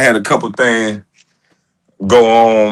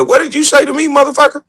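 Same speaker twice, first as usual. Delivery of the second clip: high shocked disbelief; mock shocked; faster than usual. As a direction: act stunned by the offer. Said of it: "I said".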